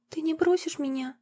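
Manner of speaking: put-on a little girl afraid of being left; girl begs her mother not to abandon her; tearful little girl.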